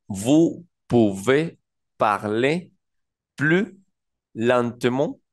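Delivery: slow, syllable by syllable French "Vous pouvez parler plus lentement?"; French phrase, slow. Shown as a demonstration. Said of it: "La primera de ellas que tenemos dice". (frase en francés, muy despacio)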